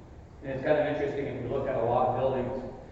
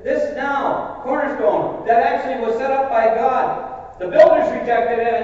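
forceful, louder preaching quote, pitched higher; very strongly; 8 semitones higher, 7 dB louder than usual. Retold or imitated. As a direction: proclaim it with full force from the pulpit. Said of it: "He says very strongly".

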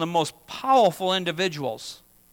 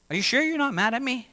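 higher, faster mock nagging; pestering repeat question; 5 semitones higher, 4 dB quieter, faster than usual. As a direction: needle them with a hurried repeat. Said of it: "I'll give you the classic example".

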